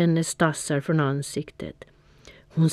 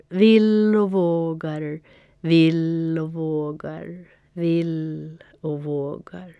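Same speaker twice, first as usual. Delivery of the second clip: slow repeated words dying away into the distance; fading echo; slower than usual. A narrator's reading. Panter's voice echoes back from the moon, slowly fading. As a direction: let it echo off through space and fade. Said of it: "ett skratt som ekade genom rymden".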